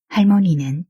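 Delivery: ordinary delivery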